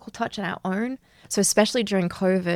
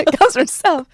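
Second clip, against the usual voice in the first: higher and louder, laughing through it; laughing emphatic repeat; 8 semitones higher, 9 dB louder than usual. Real, not acted. Podcast host laughingly repeats her point to her co-host. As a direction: say it again with a laugh, hammering it home.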